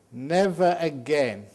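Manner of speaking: "Never" drawn out slow and loud, falling; emphatic never. Acted. Monk stressing "Never" to the meditators, slow and loud. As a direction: lean hard into "Never again". Emphatic turning point in talk.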